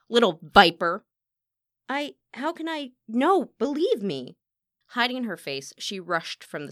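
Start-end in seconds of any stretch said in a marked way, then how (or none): 0.1-1.0 very loud, furious name-calling; explosive contempt; venomous insult. Acted. Furious singer insults her rival. 1.9-4.4 high, halting, distressed protest; stammer, then beg loudly to be believed; overwhelmed and desperate; flustered pleading. Acted. Flustered singer pleads, then flees.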